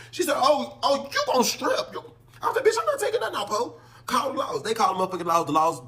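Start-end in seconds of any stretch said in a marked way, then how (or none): 0.4-1.9 high, sneering put-on guard voice; mocking guard. Officer taunting him to strip, high sneering voice. 2.6-3.8 high, sharp mock defiance; defiant refusal. Inmate refusing to strip for the guard, high defiant snap.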